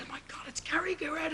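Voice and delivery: breathless starstruck whisper; awed whisper. Retold or imitated. Fan spotting Cary Grant on the studio steps, awed whisper. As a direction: hush it like you can't believe your eyes.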